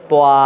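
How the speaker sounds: ত opening into "toa" as the mouth spreads at the end; wrong widened ending. Shown as a demonstration. Teacher warning students against the error, wrong widened ending. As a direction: say তোয়া, letting round lips slide wide to "aa". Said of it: "শেষে লম্বা যদি করে দেন".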